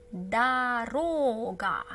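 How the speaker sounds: slow, careful syllable-by-syllable; slow model word. Shown as a demonstration. Teacher modelling the word slowly for learners. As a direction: draw it out so learners can follow. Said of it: "We see that this O is stressed".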